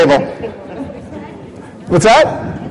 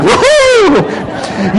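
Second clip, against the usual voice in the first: mock cheer; 12 semitones higher than usual.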